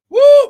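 short, loud, high "woo"; exultant whoop. Real, not acted. Preacher whoops mid-sermon.

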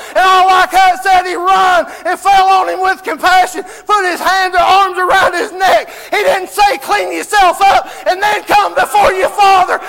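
shouted, high preacher's cry; fervent revival preaching; preaching at full force. Acted. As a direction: preach it to the back row, full voice. Preaching the lost son home.

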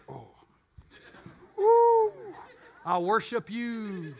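a squealed ooh, then a slow dreamy gush; swooning admiration. Retold or imitated. Preacher mock-adoring multilingual people, slow starstruck gush. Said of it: "I'm like".

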